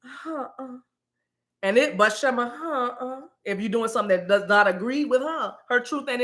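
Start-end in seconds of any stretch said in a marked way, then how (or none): 0.0-0.8 imitating sister's refusal. Host shows how her sister disapproves, soft uh-uh. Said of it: "But anyway, she'll go". 2.4-3.2 a soft long no, as a sister would; imitating sister's refusal. Host repeats sister's sign of disagreement, imitated uh-uh. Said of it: "she'll go".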